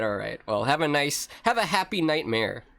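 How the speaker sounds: usual speaking voice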